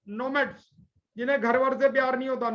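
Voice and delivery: ordinary talk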